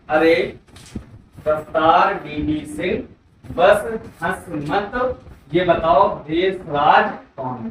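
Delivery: slow, spaced-out chant of the trick; slow mnemonic recital. Shown as a demonstration. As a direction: drill the whole rhyme slowly for students. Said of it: "क्या हो जाएगा इसका trick?". (धीमी गति से trick दोहराना)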